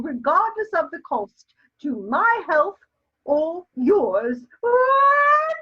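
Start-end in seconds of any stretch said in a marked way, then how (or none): 4.6-5.6 a big, high sneeze that bursts out; loud sneeze. Acted. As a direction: sneeze loudly and let it explode. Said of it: "Your sneezes are quite heartrending".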